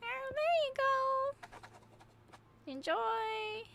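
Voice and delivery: very high sing-song send-off; high-pitched chirp. Pretend. Player hands over an order to a game customer, high put-on chirp. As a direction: squeak it out in a tiny high voice.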